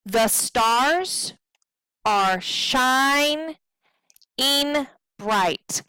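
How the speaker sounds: slow, broken, weighting "stars", "shining" and "bright"; iambic stress. Shown as a demonstration. Teacher scanning the other line, slow stressed "stars", "shining", "bright". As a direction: pause between beats, leaning on "stars" and "shining". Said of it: "So we want".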